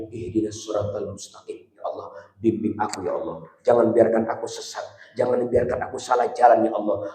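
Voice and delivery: soft, low whispered plea; quiet devoted prayer; humble prayer. Acted. Praying softly.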